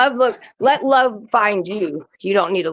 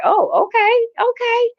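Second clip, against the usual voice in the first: much higher, slow, drawn-out okay; dawning realization; slower than usual. Retold or imitated. Wife recalling her reaction to her husband's answer, high and drawn out. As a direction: stretch it out high as it sinks in. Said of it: "I was like".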